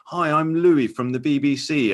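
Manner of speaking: mock the awkward cheery hello; Louis Theroux greeting. Host imitates Louis introducing himself at a door. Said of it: "doing a very good Louis".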